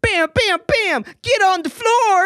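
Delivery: high, drawn-out playful yell; mock hold-up shout. Retelling her bursting into the bar with a gun, high mock shout. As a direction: shout orders like playing at robbers. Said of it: "So she's like".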